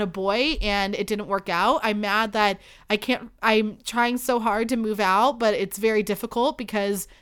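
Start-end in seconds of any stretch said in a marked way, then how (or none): none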